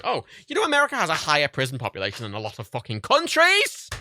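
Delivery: loud, indignant rant; furious outburst. Real, not acted. Vlogger telling viewers about America's prisons, furious outburst. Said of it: "I hope you can hear it in my voice".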